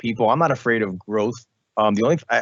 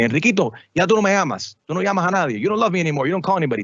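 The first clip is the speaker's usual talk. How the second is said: raised, whiny complaining father; guilt-tripping dad; 8 semitones higher, faster than usual. Retold or imitated. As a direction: play the hurt dad scolding his son for not calling. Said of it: "He'll call me".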